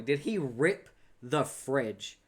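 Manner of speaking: usual speaking voice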